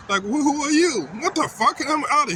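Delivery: real anger bursting out; angry outburst. Real, not acted. Son raging at his dad's negativity, high indignant shout. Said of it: "I know I'm getting kinda angry".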